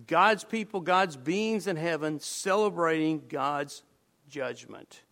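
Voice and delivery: usual speaking voice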